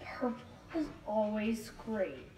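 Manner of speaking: whisper. Real, not acted. Aside while tasting.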